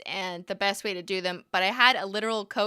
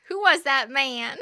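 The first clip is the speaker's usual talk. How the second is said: higher, slow Southern "who was that man?"; Southern accent, higher and louder; 5 semitones higher, 6 dB louder than usual. Retold or imitated. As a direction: replay the movie line in a Southern accent. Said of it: "Southern accent".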